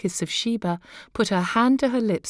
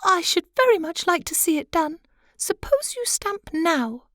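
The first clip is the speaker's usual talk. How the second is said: high, light wife's voice; high pitched fussy little wife; butterfly wife; 11 semitones higher than usual. Acted. Wife taunts husband.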